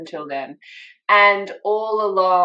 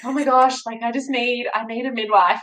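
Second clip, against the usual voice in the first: higher-pitched, mildly frantic pleading; retold frantic plea; 6 semitones higher than usual. Phoning round for a midwife, retold frantic plea. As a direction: act out a stressed caller begging for help. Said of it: "frantically calling the midwives in my area".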